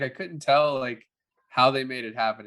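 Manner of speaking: ordinary delivery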